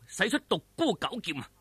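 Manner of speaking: plain reading voice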